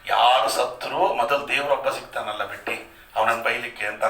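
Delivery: normal talking voice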